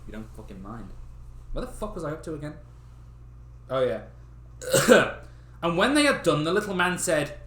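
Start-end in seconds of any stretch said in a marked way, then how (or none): none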